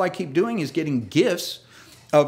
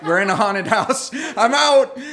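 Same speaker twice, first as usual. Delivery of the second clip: rising, louder mock panic with a laugh; mock fright; 6 semitones higher, 6 dB louder than usual. Preacher retelling his fake panic at the moving hose, louder. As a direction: play the scared guy bailing out. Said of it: "I'm like".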